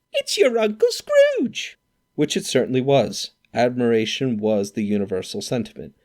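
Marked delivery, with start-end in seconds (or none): 0.1-1.8 very high, slow triumphant shriek; triumphant squeal. Acted. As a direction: draw out the big reveal in a shrill cry. Sister reveals the answer.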